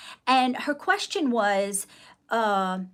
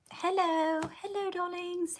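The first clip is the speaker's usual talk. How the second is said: breathy vintage purr; 8 semitones higher, 5 dB quieter than usual. Acted. Greeting viewers in put-on voice.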